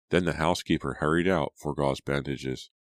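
plain reading voice

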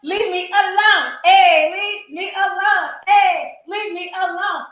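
drawn-out, high mocking leave me alone; mock sing-song. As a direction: stretch it out like a taunting chant. Mocking the clip, sing-song repeat.

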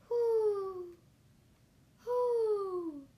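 loud, high, falling hoots; drawn-out storybook owl call. Acted. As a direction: hoot like an owl. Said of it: "asked the owl".

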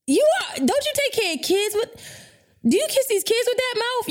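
high-pitched, stammering incredulity; stunned disbelief. Retold or imitated. Retelling being stuck for words with client, stunned. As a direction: stumble into a high, appalled question. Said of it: "I was so stuck".

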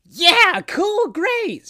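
high, loud group shout; shouted cheer. Acted. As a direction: shout it together with joy. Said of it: "shouted everyone together".

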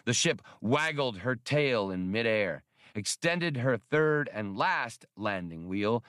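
ordinary delivery

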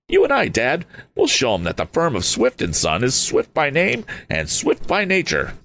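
Tom, fired up and youthful; eager young Tom. Acted.

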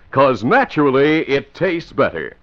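bright, high-pitched pitchman selling soda; eager old-time commercial voice; radio ad read. Acted. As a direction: sell it like a vintage announcer. Commercial read inside radio show.

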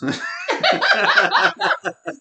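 big loud laugh; loud burst of laughter. Real, not acted. Laughing at own reading.

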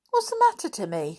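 worried question starting high; anxious. A narrator's reading. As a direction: ask with concern, pitch jumping up then dropping. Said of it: "said George anxiously".

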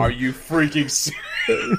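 loud, slow, laughing disbelief; laughing shout of disbelief. Real, not acted. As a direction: shout it through laughter, amazed. Hears the dog was home.

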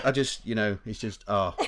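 usual speaking voice

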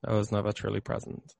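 very soft, inward repetition; quiet, sober admission; slow, reflective. Real, not acted. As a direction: let the truth land, barely voiced above a murmur. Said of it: "say it again really slowly and".

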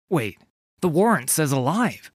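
alarmed protest. A narrator's reading. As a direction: object in sudden alarm. Condemned corsair protests.

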